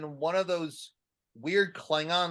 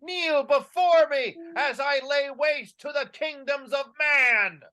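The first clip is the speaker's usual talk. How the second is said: booming villain decree, high and loud; dark lord; 7 semitones higher, 4 dB louder than usual. Acted. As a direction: proclaim like a wrathful god. Villainous god speech, shout.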